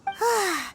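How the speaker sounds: relieved sigh. Acted. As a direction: let the breath out, relieved. Relieved sigh after finding box.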